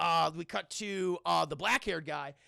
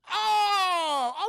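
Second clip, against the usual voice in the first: long, high, loud "oh"; hammed-up dawning realization; drawn-out realization; 12 semitones higher, 8 dB louder than usual. Spirit's realization, acted out.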